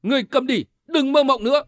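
high-pitched narrated rebuke; angry shout. A narrator's reading. Tử Văn silences the cult leader, shouting angrily. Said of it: "lớn tiếng quát".

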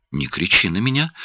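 plain reading voice

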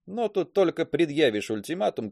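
ordinary delivery, nothing marked

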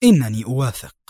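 firm, resolute acceptance; short, determined answer. A narrator's reading. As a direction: accept the challenge decisively. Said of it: "أجاب أدهم في حزم".

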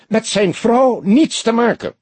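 very high, agitated outburst; high-pitched, indignant chief; voice cracking. A narrator's reading. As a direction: protest at the top of the voice's range. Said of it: "sprak hij met overslaande stem".

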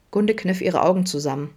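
plain reading voice

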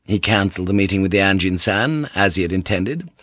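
plain reading voice

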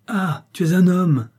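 high, exasperated exclamation; exasperated cry. A narrator's reading. Hollander discovering the monkey is a man, exasperated cry. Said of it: "cria Van Gogh exaspéré".

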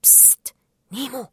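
hushed. A narrator's reading. Jacques quietly wakes sleeping Nemo, hushed call. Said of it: "اقترب جاك من نيمو بهدوء وقال".

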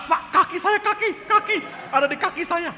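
loud, high cries of alarm; retold panic. Man with stiff legs cries to the preacher, acted panic. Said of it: "Jangan panik".

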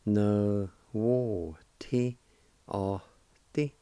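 very slow, syllable by syllable. Shown as a demonstration. Teacher slowly joining the syllables of a word. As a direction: draw each syllable out slowly for learners. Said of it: "And together".

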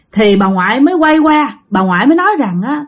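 ordinary delivery, nothing marked

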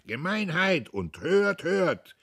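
loud, high crowd roar; bellowed outcry. A narrator's reading. Veterans' club heckles in unison, bellowing. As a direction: roar it slowly, as one man. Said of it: "brüllte wie ein Mann".

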